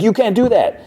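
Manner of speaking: squeaky-high mock whine of protest; high-pitched, exaggerated objection; mock indignant protest. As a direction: imitate someone protesting, voice shooting way up. Grounded character protests the punishment.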